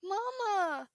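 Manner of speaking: put-on high, loud child's cry for her mother; child yelling. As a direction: scream for mama across the street. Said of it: "yells loudly".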